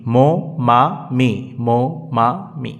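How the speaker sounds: long held vowels, one syllable at a time; drawn-out, rhythmic three-syllable drill; slow syllable drill. Shown as a demonstration. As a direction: recite slowly for pupils to repeat. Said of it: "তাহলে কি হলো?".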